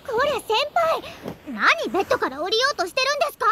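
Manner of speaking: high, loud, fast startled call; surprised. Acted. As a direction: catch him sneaking off, alarmed. Catches him leaving bed.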